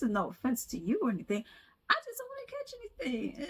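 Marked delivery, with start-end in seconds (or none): 1.9-3.5 squeaky aside. Pretend.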